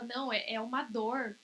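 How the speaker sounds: usual speaking voice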